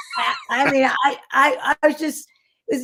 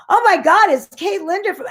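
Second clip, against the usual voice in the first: excited gasp; 7 semitones higher than usual. Retold or imitated. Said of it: "And then he went".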